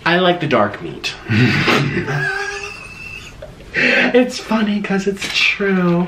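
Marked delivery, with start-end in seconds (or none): 1.2-3.5 shrieking high laugh at his own joke. Real, not acted. Laughing hard at his own mukbang joke. 3.8-6.1 slow words still carrying the laugh. Real, not acted. Still laughing at his own joke to viewers.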